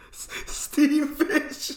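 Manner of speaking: very high, very slow, breaking into laughter; laughing hard. Real, not acted. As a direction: wheeze steam fish out through laughter.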